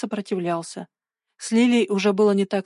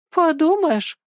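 high, dismissive huff; scornful girl's brush-off; snorted scorn; 9 semitones higher, 3 dB louder, slower than usual. A narrator's reading. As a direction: toss it after him, pitched well up. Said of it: "фыркнула она вслед".

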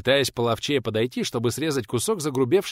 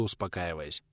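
plain reading voice

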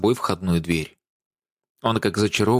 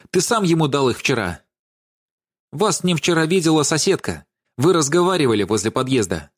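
higher-pitched, insistent accusation; blurted out; 5 semitones higher than usual. A narrator's reading. Даша accuses Владимир of giving away keys, blurting it out. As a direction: fire it off as a sudden charge. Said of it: "и Даша выпалила".

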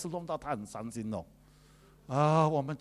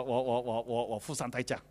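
usual speaking voice

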